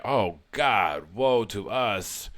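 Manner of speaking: slow, drawn-out mock wail; lamenting criminals. Criminals reading their book, in a slow mock lament. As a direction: lament it slowly like the doomed. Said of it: "And they will say".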